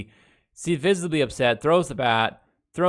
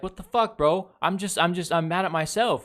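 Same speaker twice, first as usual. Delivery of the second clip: higher-pitched imitation of a player protesting; mock pissed off; 6 semitones higher than usual. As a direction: act out an upset player's protest. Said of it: "visibly pissed off".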